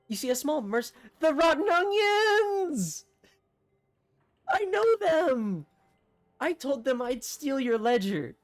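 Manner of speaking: screaming the name in terror; mayor blurting out her secret; panicked shriek. Acted.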